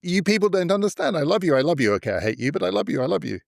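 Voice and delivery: high-pitched, hammed-up pleading; mock-aggrieved, swooping up high; plangent mock plea. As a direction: mimic a wounded leader protesting his love. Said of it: "That mixture of the plangent tones".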